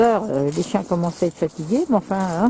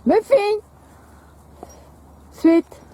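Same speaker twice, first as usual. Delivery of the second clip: dog command call; 12 semitones higher, much slower than usual. Real, not acted. Calling dogs at heel.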